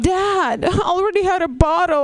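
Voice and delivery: whiny retold complaint to a parent; protesting daughter. Retold protest to her father.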